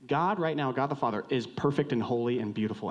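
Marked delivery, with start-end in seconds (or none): none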